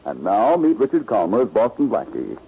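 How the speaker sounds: big, rising announcer read building to a high close; radio announcer. Acted. Announcer introducing the star to listeners, grand and rising. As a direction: sell the hero's introduction to the audience.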